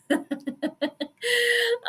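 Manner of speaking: high giggle; real high-pitched laugh. Real, not acted. Laughs after late prediction.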